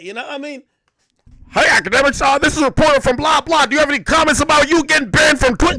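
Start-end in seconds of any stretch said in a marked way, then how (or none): 1.5-5.8 louder, brisk reporter voice; pushy reporter. Retold or imitated. A reporter calling about the ban, a brisk loud reporter voice. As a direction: rattle it off like a cold-calling reporter. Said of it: "the fucking reporter called me".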